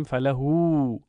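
«فله» as falahuu, final u held; slight lengthening. Shown as a demonstration. Teacher showing how we say it, final vowel lengthened. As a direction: draw out the last vowel of «فله».

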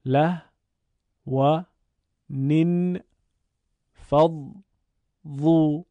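له و نین فض ظو said as separate beats; syllable by syllable. Shown as a demonstration. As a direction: sound out each syllable with a pause. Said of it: "بخشخوانی رو انجام دادیم".

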